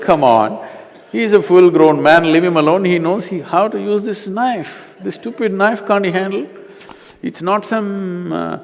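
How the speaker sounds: loud, high exasperated retort; retold exasperation. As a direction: act out fed-up protest at needless fussing. Retelling his exasperated retort.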